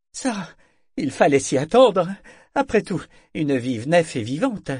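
startled merchant putting on a brave face; shaky false bravado. A narrator's reading. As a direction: bluster to hide the fright. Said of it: "La voix un peu tremblante ... se voulait pourtant fanfaron".

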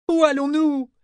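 high-pitched cry over a squall; shouted question. A narrator's reading. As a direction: call out to be heard through wind and rain. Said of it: "cria-t-elle à travers le grain". (question criée d'une voix aiguë)

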